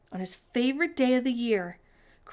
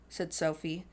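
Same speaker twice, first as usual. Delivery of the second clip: plain reading voice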